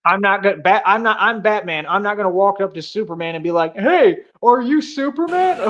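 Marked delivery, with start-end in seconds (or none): 3.8-5.6 high, drawn-out gushing question; hammed-up starstruck greeting; mock overexcited fan. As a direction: imitate a fan blurting it out, slow and high. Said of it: "surprised and overwhelmed and probably excited".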